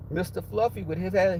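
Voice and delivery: normal talking voice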